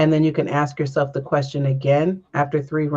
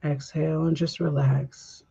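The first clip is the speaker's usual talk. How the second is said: guided breathing. Acted. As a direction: lead the breath gently, unhurried. Leads a breathing meditation.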